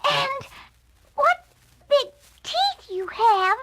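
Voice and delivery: put-on very high, timid frightened girl; a scared little voice; small voice. A narrator's reading. Girl realising the wolf's teeth, small and scared. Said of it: "in a small voice".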